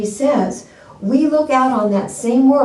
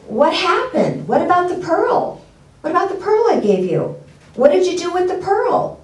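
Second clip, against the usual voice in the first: raised, pressing questions from the wealthy man; puzzled wealthy man; 9 semitones higher than usual. Acted. Wealthy man pressing the beggar, high and urgent. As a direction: demand to know where the pearl went.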